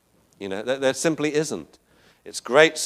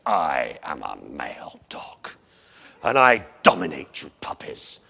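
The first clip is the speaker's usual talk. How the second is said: puffed-up male dog; 10 semitones lower than usual. Acted. Whip lording it over the puppies, puffed-up low voice. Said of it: "Whip puffed himself up and said".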